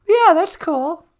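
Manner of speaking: put-on very high, loud little-girl voice; squeaky girl caller. Caller [name] greeting Bowie in a very high girlish voice. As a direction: chirp a hello like an excited kid.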